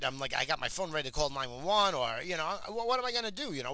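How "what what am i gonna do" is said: frazzled. Retold or imitated. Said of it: "I'm, like, frazzled".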